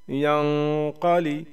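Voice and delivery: यंक़लि with a long nasal hum before क़; slow humming nasal in यंक़लि; nasal ghunna. Shown as a demonstration. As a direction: hold यं in the nose, then क़लि. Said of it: "same उसी हिसाब से".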